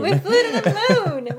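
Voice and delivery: slow laughing echo. Real, not acted. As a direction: repeat it slowly while laughing. Echoing the joke, laughing.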